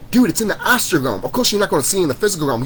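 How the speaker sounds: ordinary delivery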